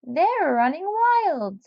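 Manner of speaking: high, slow, fussy warning; Missus Seed Nibbler warning. Acted. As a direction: drag it out like a fretful neighbour. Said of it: "Missus Seed Nibbler warned".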